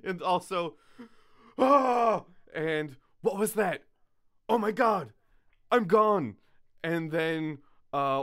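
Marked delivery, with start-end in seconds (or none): 1.6-2.2 loud, high yelp of fright; mock scared scream. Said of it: "in a very scared voice". 3.2-6.4 high, loud imitation of panic; mock scared voice. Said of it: "in a very scared voice".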